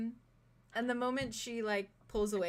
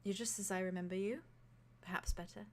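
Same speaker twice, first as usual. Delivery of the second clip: nervous soft reply; 2 semitones lower, 8 dB quieter than usual. Acted. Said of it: "smiles very kinda nervously and says".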